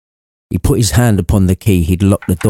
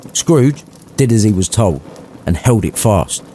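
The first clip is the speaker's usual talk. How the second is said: usual speaking voice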